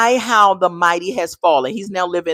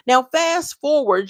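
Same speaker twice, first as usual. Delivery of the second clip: ordinary delivery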